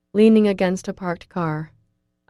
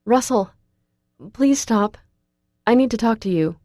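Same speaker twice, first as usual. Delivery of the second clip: urgent plea; 5 semitones higher, 6 dB louder than usual. A narrator's reading. Begging Russell in parking lot.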